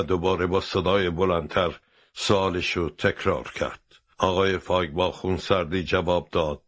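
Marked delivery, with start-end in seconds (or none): none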